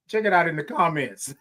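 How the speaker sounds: usual speaking voice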